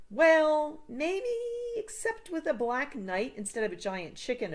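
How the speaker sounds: put-on a kid unsure, thinking it over; hesitant Sam. A narrator's reading.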